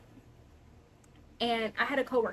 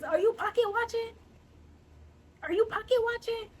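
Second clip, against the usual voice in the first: mock high question; 9 semitones higher than usual. Mocking nosy straights.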